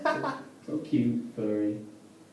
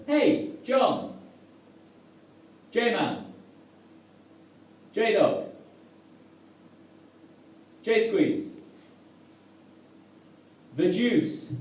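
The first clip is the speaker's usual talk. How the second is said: high, eager calling out of nicknames; excited greeting; 8 semitones higher, much slower than usual. Retold or imitated. Comic to old mate John, excited greeting. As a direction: hail him like a long-lost pal.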